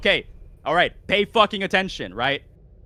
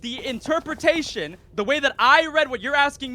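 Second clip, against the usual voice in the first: high, raised voice pushing through; heated insistence; 8 semitones higher than usual. Real, not acted. Debater forcing his interpretation through, heated. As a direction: insist forcefully over the interruption.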